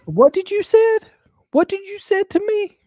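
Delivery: very high, squeaky demand; mock-serious little girl. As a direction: imitate an indignant child, climbing sharply. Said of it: "this real serious look on her face".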